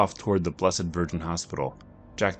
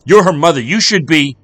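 high, loud furious snarl back; a husband yelling while driving; angry retort; 10 semitones higher, 16 dB louder than usual. Acted.

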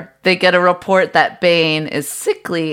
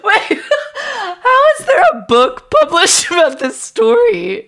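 high, giggly incredulous question; laughing disbelief. Real, not acted. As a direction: ask it while cracking up.

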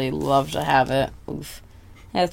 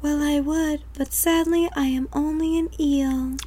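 much higher, prim sorry creature; eel voice; 11 semitones higher, 3 dB quieter than usual. Acted. Eel politely refusing to help, high and prim. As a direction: squeak it like a polite apologetic eel.

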